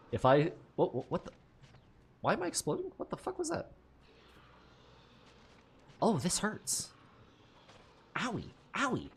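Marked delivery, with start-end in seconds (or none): 2.2-3.7 high startled disbelief; real shocked squeak of confusion; shocked outburst. Real, not acted. Sudden explosion, shocked. 6.0-9.1 mock owie. Mock pain, cute whimper.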